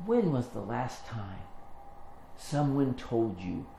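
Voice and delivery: very soft, measured question; tender. Retold or imitated. Older woman tells young rapper he matters, tenderly. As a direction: ask it gently, with pauses. [name] tenderly addresses [name].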